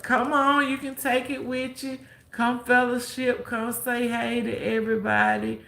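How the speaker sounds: high, loud church-lady coaxing; imitating insistent coaxing. Church lady urges Toya to fellowship, loud coaxing imitation.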